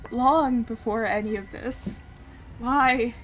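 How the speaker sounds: loud, slow, pained remembering; anguished dying character. Acted. Character remembering his true name and death, anguished. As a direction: let every word ache with pain.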